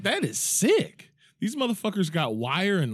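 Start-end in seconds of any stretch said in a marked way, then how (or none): 0.0-1.1 shocked disgust. Retold or imitated. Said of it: "I was like".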